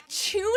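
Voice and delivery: tuna as CHOO-na, high and rising; British tuna. Retold or imitated. Never having heard it before, incredulous CHOO-na for tuna. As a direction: squeak tuna with a ch, in disbelief. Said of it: "That I've never heard".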